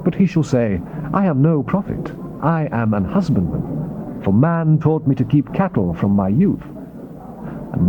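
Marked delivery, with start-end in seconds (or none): none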